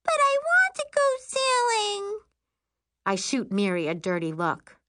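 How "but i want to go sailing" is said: put-on very high childish wail; high-pitched tantrum whine; upset little girl. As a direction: protest as a child about to cry. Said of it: "Prissy's face crumples".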